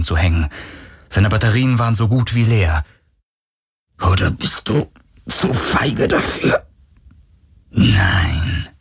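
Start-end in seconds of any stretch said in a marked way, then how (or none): 4.0-6.7 slow, effortful challenge; strained taunt. A narrator's reading. Weakened hero taunts the creature. 7.7-8.7 very low, drawn-out phantom; murmuring ghost voice. A narrator's reading. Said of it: "raunte die Geisterstimme".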